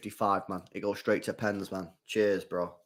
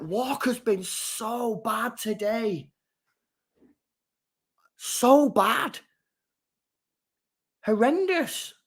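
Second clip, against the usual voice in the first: disgusted; 6 semitones higher, much slower than usual. Real, not acted. Slating a poor defender.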